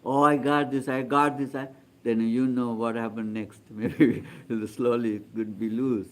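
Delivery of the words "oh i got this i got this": a touch of mock excitement, slightly raised; lightly acted excited boast; mildly excited. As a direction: act out a small burst of delight at a gain. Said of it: "don't want to be very excited".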